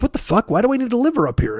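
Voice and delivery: high, exasperated voice of Jesus; indignant Jesus. Acted. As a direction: play Jesus fed up with the question.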